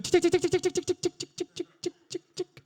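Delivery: high rapid tongue ticks slowing down; ticking noise. Retold or imitated. Woman silently calibrating the man, mechanical high ticking. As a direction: tick like a dial winding down. Comic mimes a calibrating machine.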